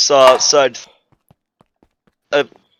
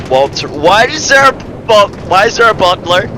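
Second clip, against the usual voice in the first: a touch higher, louder query to the butler; Integra asking; 5 semitones higher, 4 dB louder than usual. Acted. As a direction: call to the butler with mild surprise. Narrator voices Integra asking butler.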